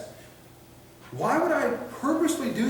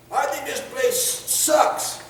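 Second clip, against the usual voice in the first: slow, higher, dragged-out gripe; sullen complainer; 8 semitones higher, much slower than usual. Retold or imitated. Citizen grumbling to officer about the place, slow mocking whine.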